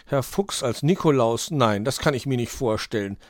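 loud, high-starting grumble of disbelief; Borstel grumbling. A narrator's reading. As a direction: balk sulkily at the idea. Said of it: "maulte Borstel".

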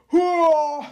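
a joyful cheer; hammed-up happy cheer. Fox cheers.

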